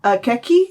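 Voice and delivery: "Akeki" with a hard k before the last vowel, questioning; mistaken pronunciation. Shown as a demonstration. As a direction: try "Akeki" with a k in the last syllable, rising. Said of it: "No, no, that's an H".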